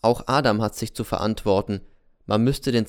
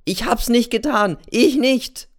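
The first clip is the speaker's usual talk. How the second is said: loud, much higher cry of protest; screamed denial; 13 semitones higher, 6 dB louder than usual. Acted. Boy N denies breaking the box, screaming high and loud. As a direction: scream it out, cornered. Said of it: "schreit der N".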